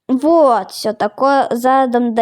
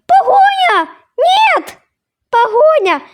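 shrill panicked yelps, much higher and louder; mock panic; 14 semitones higher, 3 dB louder, slower than usual. Kid driver panicking at a police chase, hammed-up shrieks. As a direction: shriek in playful fake terror at the chase. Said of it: "а страшно".

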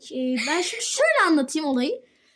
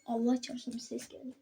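hushed; 3 semitones lower, 11 dB quieter than usual. Real, not acted. Hearing a noise, hushing.

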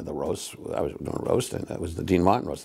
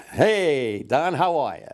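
high, swooping stage hello; big showbiz greeting; 6 semitones higher, much slower than usual. Retold or imitated. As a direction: make a grand entrance with it. Acted repeat of entrance greeting.

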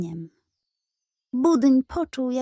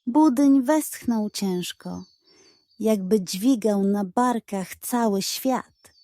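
usual speaking voice

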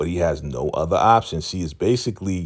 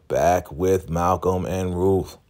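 ordinary talk, nothing marked

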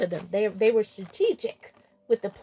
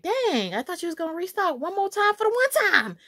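higher-pitched imitation of a let-down shopper; mock dismay; 6 semitones higher than usual. Vlogger imitating shoppers who missed restock, mock dismay. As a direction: play up the shopper's complaint.

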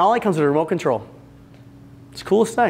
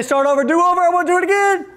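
high, pleading whine, begging for a redo; whiny do-over plea; 11 semitones higher than usual. Pretend. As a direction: beg to start over like a kid. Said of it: "We don't get to say".

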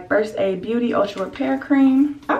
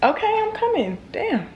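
high, called out to someone in another room; calling out; 11 semitones higher than usual. Real, not acted. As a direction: call back to someone, pitched up.

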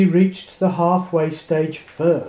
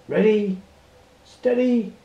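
crying out; 5 semitones higher than usual. A narrator's reading. Starter calling the tractor race start, building to a shout. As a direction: call the start, climbing to a shout. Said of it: "cried the starter".

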